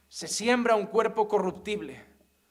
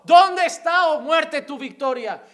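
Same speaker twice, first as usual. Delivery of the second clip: very high, loud cry; Paul's shout; 8 semitones higher, 12 dB louder, slower than usual. Acted. Paul defying death, shouting. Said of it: "Pablo gritó".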